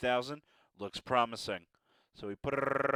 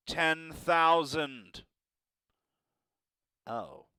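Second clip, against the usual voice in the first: ordinary delivery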